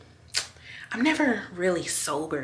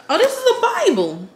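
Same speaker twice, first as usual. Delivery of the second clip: much higher and louder, sudden discovery; surprised; 12 semitones higher, 7 dB louder than usual. Real, not acted. Finding a Bible in the clinic bag, surprised. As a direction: caught off guard by what she pulls out.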